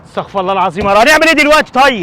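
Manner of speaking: loud, high despair; exasperated outburst. Acted. Father at his wits' end, loud exasperation. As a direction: throw up your hands in frustration.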